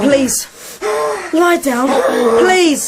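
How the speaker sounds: high, loud desperate pleading; anxious rising pleas; urgent pleading. Acted. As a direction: beg him to lie down. Boy pleading with monk.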